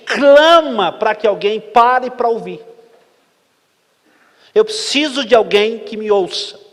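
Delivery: loud, high, slowed-down preaching; preacher crying out to the congregation; preaching at full force. Acted. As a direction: plead for someone to stop and listen. Preacher's forceful closing appeal.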